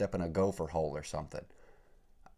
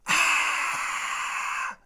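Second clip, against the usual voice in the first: breathy swish; 6 dB louder than usual. Pretend. Imitating the swish sound.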